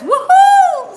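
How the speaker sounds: high, loud cheering whoop; a big loud celebratory woohoo; excited woohoo. Retold or imitated. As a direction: cheer it like the thrilled viewer. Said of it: "Yvette says".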